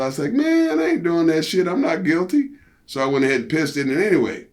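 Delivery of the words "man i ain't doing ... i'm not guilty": high, defiant protest; a high, huffy I'm-not-doing-it; retold defiance, pitched way up; indignant refusal.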